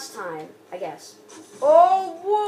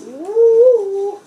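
long, loud, high "woo"; excited whoop; 4 semitones higher, 9 dB louder than usual. Real, not acted. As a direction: let out a drawn-out whoop. Cheering in the game.

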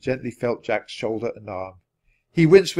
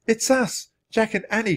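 high, excited announcement; pitched-up girl's cry; 10 semitones higher than usual. A narrator's reading. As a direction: call out who you are to rescuers. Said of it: "cried Annie".

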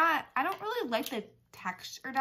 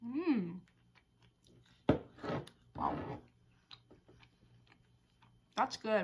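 talking with mouth full; mid-bite chewing; chewing food; 4 dB quieter, slower than usual. Real, not acted. Seen 0: Man bit chicken at -7, cheeks bulge chewing while speaking.

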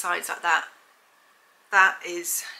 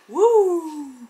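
loud, high joyful whoop; excited whoop; 10 semitones higher, 10 dB louder than usual. Real, not acted. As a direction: cheer it out with delight. Excited pregnancy news cheer.

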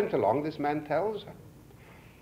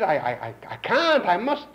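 stammering plea, much higher and louder; pleading; 6 semitones higher, 7 dB louder than usual. Retold or imitated. Guest pleading with host to let him leave, mock pleading. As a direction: beg to be let go, stuttering. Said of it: "The man pleaded".

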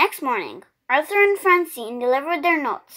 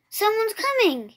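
a higher, eager call; excited cry; 7 semitones higher than usual. A narrator's reading. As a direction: call out from the window. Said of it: "he cried".

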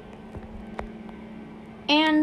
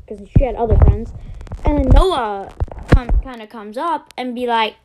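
usual speaking voice

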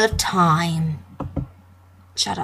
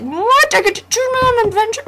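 much higher, fast, giddy disbelief; excited; 13 semitones higher, 4 dB louder than usual. Retold or imitated. Retelling his own joy at choosing his adventure, excited. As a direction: squeal it out in excitement. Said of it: "I was like".

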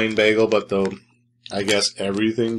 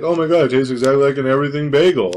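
quick, lightly hammed-up ad-actor gushing; mildly exaggerated amazement, rattled off fast; mock commercial enthusiasm; 3 semitones higher, faster than usual. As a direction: imitate an easily impressed ad taster. Mimics people in a commercial.

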